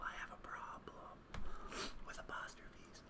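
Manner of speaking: mock-confessional whisper. Player confessing an apostrophe habit, whispered. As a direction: whisper it like a guilty secret.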